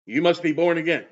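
lightly voiced blunt reply; strict, to the point. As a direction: cut straight to the point, a touch stern. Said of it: "Jesus gets strict to the point".

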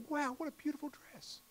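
high, sweet mock-admiring wow; delighted compliment. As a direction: gush it with honest wonder. Pastor voices Georgia's genuine compliment.

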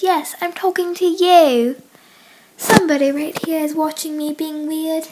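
hammed-up jolly British accent. Greeting viewers for the accent challenge, put-on British accent.

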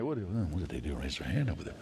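low, softened mock grumble; hushed disapproval. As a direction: grumble aside to a neighbour.

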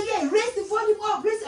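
high, eager calling out; imitated excited neighbour. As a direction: mimic a neighbour cheering from a window.

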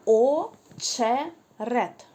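«очерет» stretched slowly, each sound clear; slow, drawn-out teaching pronunciation; drawn-out word. Shown as a demonstration. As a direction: pull «очерет» out very slowly so the «ч» is heard. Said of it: "Де ви чуєте звук «ч»".

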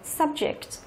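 "Subject" repeated softly, stressed on sub; first-syllable stress. Shown as a demonstration. Teacher repeats option A for students, soft "Subject" stressed on sub. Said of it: "trọng âm rơi vào âm thứ nhất".

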